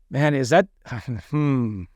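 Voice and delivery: a deflated sigh, let the hope drain out; audibly disappointed with a sigh. Shown as a demonstration. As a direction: sound let down by the number. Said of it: "sound disappointed".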